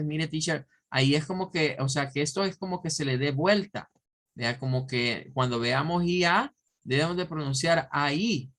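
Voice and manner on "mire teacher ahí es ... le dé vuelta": ordinary delivery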